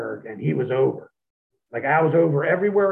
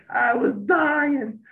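laughing; 12 semitones higher, much slower than usual. Real, not acted. Laughing at New Jack's chainsaw entrance.

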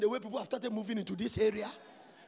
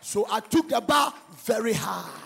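preaching shout; 8 dB louder than usual. Real, not acted. Preaching at full force.

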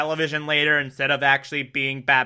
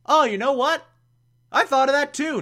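high-pitched mock realization; commissioner; 11 semitones higher, faster than usual. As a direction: pipe up high as if it just struck you. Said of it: "the commissioner's like".

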